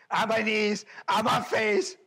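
urgent plea. Acted. Pastor acting out his emergency prayer, high and urgent.

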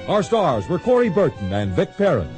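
usual speaking voice

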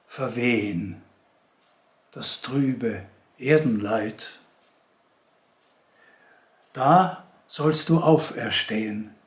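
measured poem reading with long pauses between lines; poetry recitation. Acted. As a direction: recite it solemnly, lifting and stressing key words, letting each verse stand. Liest Eichendorff-Gedichte vor. (Gedichtvortrag, getragen und mit langen Pausen)

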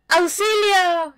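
high, slow shrill scream for help; screamed help, high and drawn out; cry for help. Acted.

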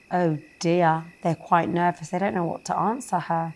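ordinary delivery

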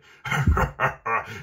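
big, loud villain laugh; robber's loud laugh. A narrator's reading. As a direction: roar with mocking laughter. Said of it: "Da lachte der fremde Mann".

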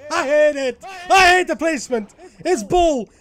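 loud, high-pitched rage; angry shouting. Real, not acted. Gamer hating the level's placement, angry shouting. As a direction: yell each complaint in a burst.

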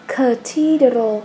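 "cathedral" drawn out slowly, a hard k sound up front; slow model word. Shown as a demonstration. Teacher models a word for her pupils, slow and clear. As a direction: spell out "cathedral" syllable by syllable with a clear k. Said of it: "Còn lại chúng ta đọc là âm cờ".

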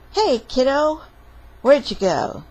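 put-on loud storybook dad calling his kid. Papa searching for little fox, calling out. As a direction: call out loudly for the child. Father calls for vanished child.

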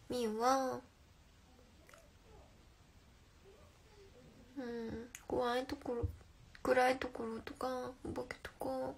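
put-on slow, coy helpless girl voice; cutesy act. Viewer asks her to act cute, so a coy plea. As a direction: play the fragile cutie begging for rescue. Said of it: "ブリッコチテ".

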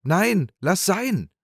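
begging from inside the trunk not to be thrown; frantic call from the chest. A narrator's reading. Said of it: "rief der Küster in der Truhe".

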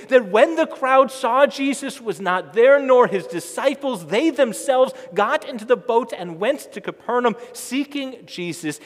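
loud, building sermon delivery; full-force preaching. Acted. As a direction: preach it out to the whole congregation.

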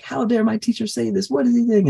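retold indignant outburst. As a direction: play the offended student sputtering at his teacher. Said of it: "And Sanat Kumara got really angry".

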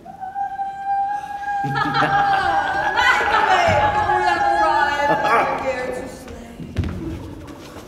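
big rolling laugh, pitched high; amused laughter at the old man; long hearty laugh. Acted. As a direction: let a long laugh roll out. Laughter after the list of genres.

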